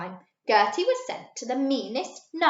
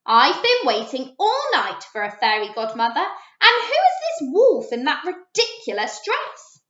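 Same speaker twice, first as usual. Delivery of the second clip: scornful; 4 semitones higher, 6 dB louder than usual. A narrator's reading. Cinderella scornful in story.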